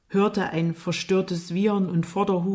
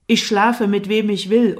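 louder, emphatic retort; angry defiance; 3 semitones higher, 8 dB louder than usual. A narrator's reading. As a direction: snap back with raised voice. Jimmy weist Vorwurf wütend zurück. (trotzig und wütend)

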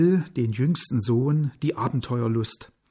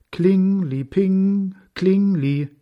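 higher, drawn-out ringing syllables; bell imitation; 6 semitones higher, 5 dB louder, slower than usual. A narrator's reading. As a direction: let the syllables ring like a small bell. (gedehnter, klingender Glockenton)

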